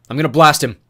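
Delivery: loud, high call; yelled from afar. Acted. Lawrence yelling from the van, loud. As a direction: shout it across to the street. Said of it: "Lawrence yelled from the van".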